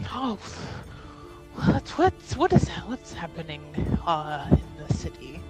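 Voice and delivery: ordinary delivery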